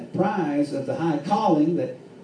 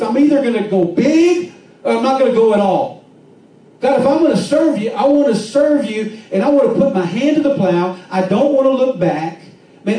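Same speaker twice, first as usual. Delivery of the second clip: raised, louder earnest vow; fervent believer's vow; 6 semitones higher, 7 dB louder than usual. Retold or imitated. Preacher voicing an eager believer's vow to God, fervent. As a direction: declare it like an all-in believer.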